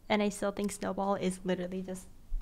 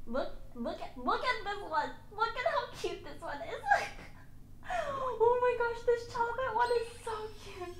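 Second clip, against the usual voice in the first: cooing over kittens; 13 semitones higher than usual. Real, not acted. Showing viewers kitten photos, squealing high coo. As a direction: squeal like you just saw the cutest kitten.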